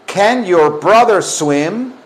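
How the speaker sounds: slow, carefully spaced question for repeating; slow teacher model. Shown as a demonstration. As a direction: model it word by word, clearly. (modelo lento y claro para repetir)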